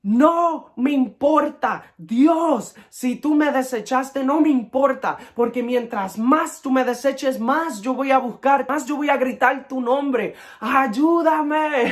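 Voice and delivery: crying out to God, voice pitched high; high, impassioned cry for help; retold desperate shout. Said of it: "Yo grité".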